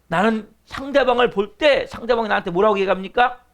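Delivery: ordinary talk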